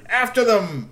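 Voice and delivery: high, loud order; commanding shout. Acted. Strongbones orders the chase, loud and high. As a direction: send them running.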